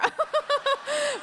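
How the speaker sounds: a high, loud laugh breaking out; real laughter at her own joke; high burst of laughter. Real, not acted. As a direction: crack up on a high squeal. Laugh after the punchline.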